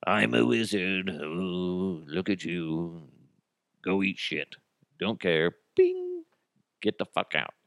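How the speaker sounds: drawn-out, unenthusiastic wizard voice; lackluster, lazy magic act; half-assed wizard. Acted. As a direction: play a wizard who can't be bothered. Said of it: "what if you were a half-assed wizard?".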